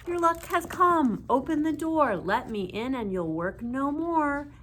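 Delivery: high, loud fairy chant at the door; fairy voices. A narrator's reading. Fairies coaxing the old woman to open. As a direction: call sweetly through the door like a fairy.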